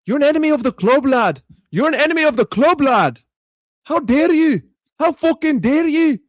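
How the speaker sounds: loud, high, outraged Scottish football fan; mock Scottish accent. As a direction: rant in a hammed-up Scottish accent. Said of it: "All I can do is Scottish".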